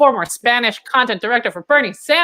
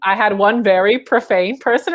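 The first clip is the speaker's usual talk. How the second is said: normal talking voice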